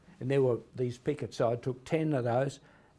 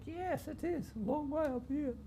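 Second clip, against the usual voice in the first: much higher and softer, a tiny squeak; squeaky little voice; 13 semitones higher, 8 dB quieter than usual. Retold or imitated. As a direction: put on the lady's small squeaky voice. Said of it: "a little squeaky voice".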